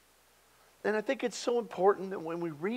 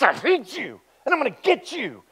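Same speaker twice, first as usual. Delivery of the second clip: higher, louder, snarled threat; menacing villain; 7 semitones higher, 6 dB louder than usual. Shown as a demonstration. Pastor demonstrating a narrator voicing a threat, menacing. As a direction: spit it like a villain who means it.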